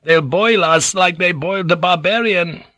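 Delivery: high tearful wail; high-voiced weeping villager. A narrator's reading. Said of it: "Ninjin had wept".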